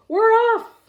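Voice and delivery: loud, high cheer; everyone shouting. A narrator's reading. The crew shouts as they set sail, loud and high. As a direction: yell it as the ship sets sail. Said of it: "We all shouted".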